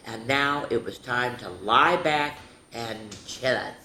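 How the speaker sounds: put-on lively read-aloud for little kids; storybook narration. A narrator's reading. Teacher reading Stanley's school story to children. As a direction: tell it like a bedtime story to a class. Reads picture book to children.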